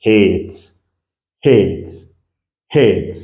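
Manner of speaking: hate with a clear "ay", falling each time; English long A. Shown as a demonstration. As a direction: model hate three times, pausing between. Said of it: "va avoir son son en anglais".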